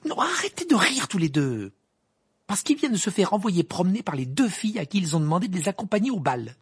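high, loud exasperated outburst; annoyed scolding. Acted. Ginny exposing the boys who laugh, annoyed. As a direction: tell them off indignantly.

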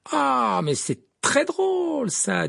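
high, drawn-out sarcastic exclamation. A narrator's reading. Hermione to laughing Slytherins, sarcastic. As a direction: stretch it high with heavy sarcasm. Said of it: "dit Hermione d'un ton sarcastique".

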